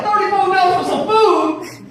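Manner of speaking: very high, loud disbelief; mock outrage. Husband learning the price of his meal, shrieked disbelief. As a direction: shriek the question in comic shock.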